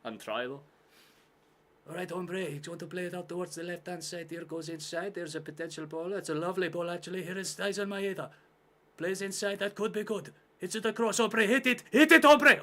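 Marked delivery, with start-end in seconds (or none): 1.9-12.6 put-on high-pitched Mexican accent commentary, building to a shout; excitable Mexican-accented match commentator, rising to a yell. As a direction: commentate in a put-on Mexican accent, getting louder and more urgent. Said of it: "you want me to do a Mexican accent".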